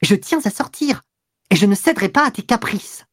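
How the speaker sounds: high, emphatic, firm retort; hard refusal. A narrator's reading. Mistress refusing her pleading lover, harsh and decided. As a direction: refuse sharply and squarely. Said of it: "répliqua nettement, durement".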